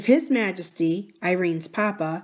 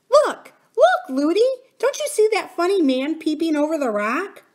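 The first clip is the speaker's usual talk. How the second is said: put-on very high little-girl exclamation; excited child princess; 10 semitones higher, 5 dB louder than usual. A narrator's reading. Princess showing nurse a funny man, high excited cry. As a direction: cry out high, then ask eagerly.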